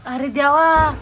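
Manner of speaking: loud. Real, not acted. Reaction to the chilli heat.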